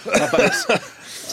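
laughing through words. Real, not acted. Laughing while starting to speak.

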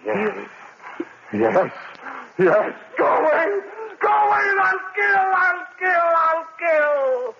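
man surrendering to the voice; frenzied shout. Acted. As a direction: break into murderous frenzy.

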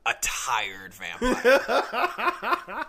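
very slow, high, weary vampire; tired vampire. Shown as a demonstration. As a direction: drag it out like an exhausted vampire. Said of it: "do a vampire, but then do".

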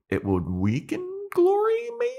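very high, thin-voiced hesitation; tiny, tentative squeak; squeaky unsure voice. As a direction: let the pitch shoot up as confidence runs out. Voicing Willow hesitantly pitching plan.

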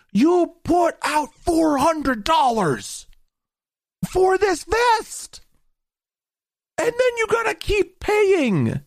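high, slow, nasal-free mock voice; mocking high whine. Mocking the company's demand.

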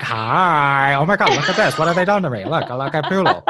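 high, laughing put-on voice; mock Jill Zarin voice. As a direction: play the shocked vain diva. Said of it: "She's like".

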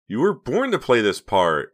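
high, loud, gleeful encouragement; enthusiastic. A narrator's reading. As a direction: gush it with big enthusiasm. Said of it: "Reva said enthusiastically".

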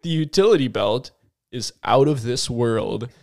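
low, measured trailer voice; mock-serious low announcer; movie-trailer announcer. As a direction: pitch it like an epic film preview.

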